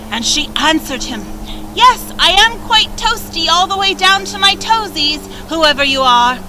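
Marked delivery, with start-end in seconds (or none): 1.7-6.4 put-on high, cheerful young girl; sweet girl's voice. A narrator's reading. Kind girl answers again.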